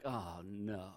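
low, quiet groan of dismay; sinking "here we go" under the breath; dread. Acted. Imagined reaction at seeing Saul coming.

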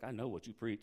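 hint at a threat, lean on "know"; imitating a confrontational churchgoer, low and pointed; ominous version. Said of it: "It's like".

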